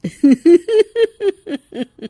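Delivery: high, loud mocking cackle; witch cackle. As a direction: laugh off the bribe like a witch. Witch mocks thieves' offer.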